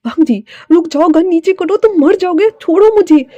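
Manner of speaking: high, urgent and rising; desperate acted outcry; panicked urging. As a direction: plead in alarm, climbing in pitch and volume. Fei struggles over the gun.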